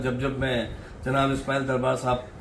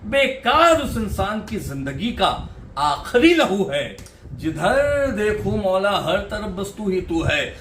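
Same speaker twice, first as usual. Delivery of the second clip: raised, ringing recital voice with lingering repeated lines; poetry recitation; 5 semitones higher, 4 dB louder than usual. Acted. Poet reciting a naat for the composer and audience, in full recital voice. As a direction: declaim each verse to the hall like a mushaira poet. Recites his devotional poem on stage.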